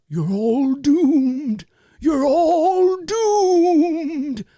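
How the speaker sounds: high, very slow, shaky prophet of doom; Crazy Ralph. Retold or imitated. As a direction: draw out a trembling warning that they are all doomed. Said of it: "his classic line, right, would be".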